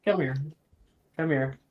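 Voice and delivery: usual speaking voice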